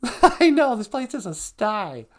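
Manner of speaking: high, loud put-on character voice; sidekick voice. Sidekick agreeing about the unclean floors, high put-on voice.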